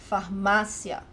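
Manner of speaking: Farmácia said slowly, MÁ stressed. Shown as a demonstration. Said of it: "the syllable with the graphic accent".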